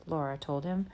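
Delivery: ordinary delivery, nothing marked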